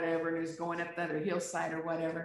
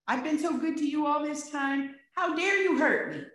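high, louder whiny indignation; imitating a self-righteous person, higher and louder; mock outraged self-pity; 8 semitones higher, 5 dB louder than usual. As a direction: mimic someone wounded and full of themselves. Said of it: "Who are we to say".

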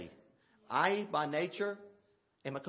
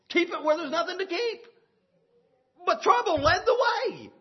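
loud, high fiery sermon with bursts of laughter; full-force preaching; 7 semitones higher, 6 dB louder than usual. Acted. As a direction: preach it out to the whole church, higher and louder.

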